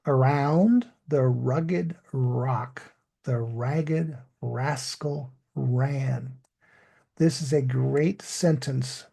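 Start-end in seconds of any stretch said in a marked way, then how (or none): 0.1-6.4 slow, deliberate "Around the rugged rock, the ragged rascal ran" with clearly sounded English R; careful model reading, full English R in "rugged", "rock", "ragged", "rascal", "ran"; tongue twister demo. Shown as a demonstration. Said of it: "Here's the tongue twister that illustrates the problem".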